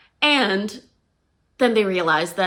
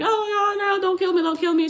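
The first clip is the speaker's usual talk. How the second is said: high, pleading, half-hearted sob; mock crying plea; 11 semitones higher than usual. Victim begging the heroine not to stab, mock-crying. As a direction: beg for your life in a wobbly high voice. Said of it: "First person crying".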